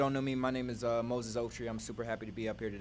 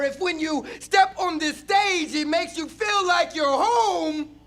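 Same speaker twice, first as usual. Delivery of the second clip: higher, louder, slow shout; 17 dB louder, much slower than usual. Acted. Poet shouting to the audience at the poem's peak. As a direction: swell into a shout.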